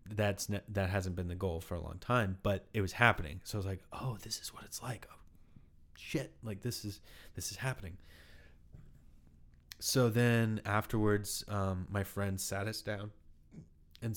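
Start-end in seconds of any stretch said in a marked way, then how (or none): 3.9-8.0 hushed whisper of realisation; whispered inner thought while weeping on stage; awed whisper. Retold or imitated. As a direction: breathe it out as it dawns on you.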